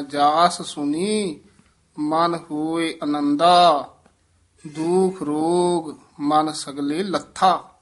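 slow, with ਹੋਏ said fully as two vowels in ਮਨ ਹੋਏ ਆਨੰਦਾ; slow careful demo. Shown as a demonstration. Teacher slowly modelling the line for the students. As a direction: spell out ਹੋਏ gently, at a slow recitation pace. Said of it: "ਹੋਏ ਉਚਾਰਨ ਕਰਨਾ ਹੈ".

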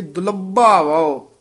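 ਦੁਲਭਾਵੋ stated clearly as the model; model pronunciation. Shown as a demonstration. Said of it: "ਇਸ ਤਰ੍ਹਾਂ ਉਚਾਰਨ ਕਰਨਾ ਹੈ".

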